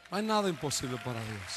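ordinary talk

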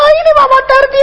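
high, hammed-up wail; exaggerated cry of a preacher fearing hunger; mock whining. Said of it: "en lugar de hablar bien decimos".

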